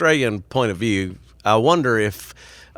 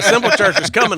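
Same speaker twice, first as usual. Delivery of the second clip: retold announcement; 10 semitones higher, 5 dB louder than usual. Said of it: "when I called Posadas and said".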